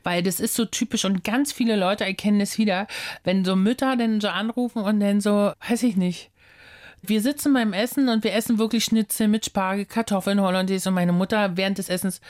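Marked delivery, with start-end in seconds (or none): none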